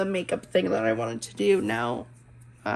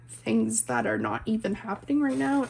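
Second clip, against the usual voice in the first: ordinary talk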